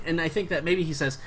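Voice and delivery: usual speaking voice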